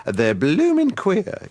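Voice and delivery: high, slow camp voice; hammed-up flamboyant retort; camp reply. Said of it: "And he'd say".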